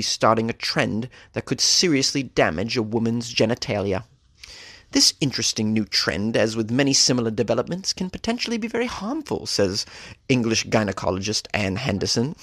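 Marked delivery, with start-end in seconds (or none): 4.9-9.5 a light put-on British accent reading the quote. News host reading the doctor's quote in a British accent. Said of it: "Do you like the accent?".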